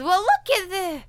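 put-on high, loud old-timer; old prospector voice. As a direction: exclaim like a crusty old man. Voicing grandpa.

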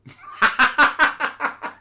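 high breathy disbelieving laugh burst; incredulous laugh. Real, not acted. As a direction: laugh in sheer disbelief at the reply. Incredulous laugh at rude email.

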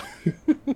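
warm burst of laughter; real giggle at the joke; laughing. Real, not acted.

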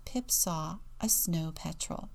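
plain reading voice

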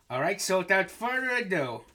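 normal talking voice

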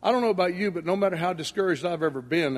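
ordinary delivery

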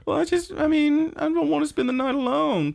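very high, put-on whimper of an excuse; needy character voice. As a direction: play a lonely cartoon friend pleading to stay. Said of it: "And she's like".

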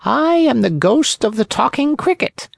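high, thin voice from far away; faint distant ghost voice. A narrator's reading. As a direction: sound like a ghost from another world. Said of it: "in a faint voice that sounded as if it came from a faraway world".

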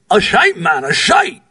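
exasperated Scottish brogue, voice leaping up on the last "shite"; frustrated Scottish brogue. Retold or imitated. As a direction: insist in Scottish brogue, fed up. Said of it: "Big John exclaimed again, frustrated".

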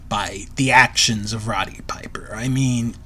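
hushed whisper. Real, not acted. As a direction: drop to a whisper. Aside about disgusted Vince.

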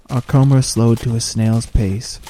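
ordinary delivery

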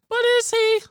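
very high, incredulous squeak; mock doubt. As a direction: question it in a high teasing squeak. Mocking doubt about Leto.

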